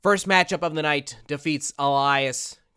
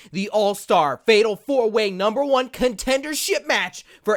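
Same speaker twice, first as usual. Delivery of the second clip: hype announcer; 3 semitones higher, 4 dB louder than usual. Acted. Hyping tonight's show for viewers, announcer voice. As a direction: sell the card like a wrestling promo.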